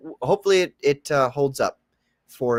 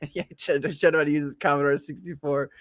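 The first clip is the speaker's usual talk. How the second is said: usual speaking voice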